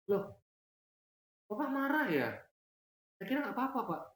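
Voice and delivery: put-on high, innocent kid voice; surprised son. Son surprised his father is angry, high and innocent. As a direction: sound baffled that dad is angry.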